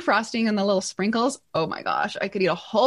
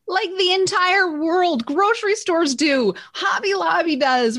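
excited disbelief; 7 semitones higher than usual. Real, not acted. Incredulous at rivals selling liners.

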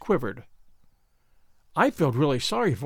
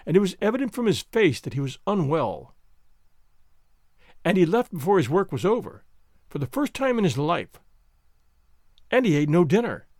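ordinary delivery, nothing marked

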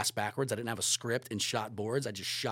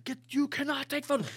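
much higher, louder mock yell; retold yell; 5 dB louder than usual. Staff at Spielberg's gate yelling, mock loud yell. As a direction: shout him down like a guard at the gate. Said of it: "yelling at me".

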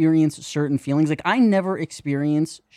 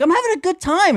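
put-on much higher, breezy party-girl voice; mock girlfriend; faster than usual. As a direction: play the clueless girlfriend who won't leave. Other girlfriend refuses to leave party.

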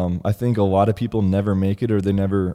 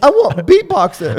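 put-on high, loud little-kid voice with a laugh; toddler voice; 13 semitones higher, 9 dB louder than usual. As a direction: babble it like a two-year-old. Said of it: "sound like you're two".

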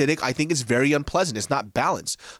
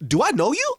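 taken aback; 11 semitones higher, 3 dB louder than usual. Retold or imitated. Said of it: "I was so taken aback".

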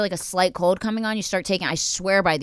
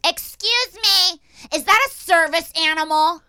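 high, slow, loud mocking clerk voice; screaming clerk; 12 semitones higher, 6 dB louder, slower than usual. Mocking the post office clerk who yelled across the counter, high and loud. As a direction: screech it out like a rude clerk. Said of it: "this lady behind the counter screams".

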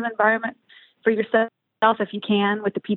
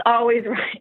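laughing through the words; 2 semitones higher, slower than usual. Real, not acted. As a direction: breaks into a laugh on right.

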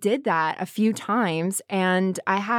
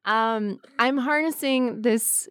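ordinary delivery

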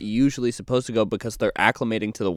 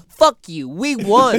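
higher, louder mock taunt at a shark; mock gloating; 9 semitones higher, 6 dB louder than usual. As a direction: gloat loudly like you just won. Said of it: "be like".

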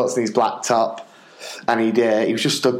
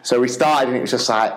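normal talking voice

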